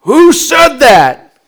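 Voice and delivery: high, loud, drawn-out demand; angry teacher. Retold or imitated. Shop teacher challenging the class, imitated stern shout. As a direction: call out like an affronted teacher. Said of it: "the teacher going".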